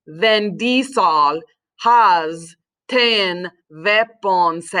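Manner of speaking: very slow and low, VIN DIESEL HAS TEN WEAPONS in separate syllables; mnemonic pronunciation. Shown as a demonstration. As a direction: drag out each syllable, WEAPONS as "vep-pon-say". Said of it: "you have to say it like this".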